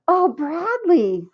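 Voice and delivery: high, slow dismayed; exasperated sigh. A narrator's reading. Mother dismayed at spilled juice, slow high. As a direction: let it out in disappointment.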